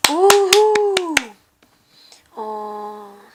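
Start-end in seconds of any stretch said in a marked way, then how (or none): 0.1-1.1 long, high, loud whoop; excited whoop. Real, not acted. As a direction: stretch out a gleeful whoop. Cheering the first nominee. (신나서 길게 환호)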